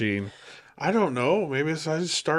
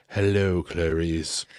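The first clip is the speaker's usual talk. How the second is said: lightly voiced creepy Lecter, lower; Hannibal Lecter; 6 semitones lower, slower than usual. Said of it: "just look up and be like".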